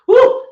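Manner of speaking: high whooping cheer; loud woo. Real, not acted. Host whooping at the live audience. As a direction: whoop it out to the listeners. Hype shout on air.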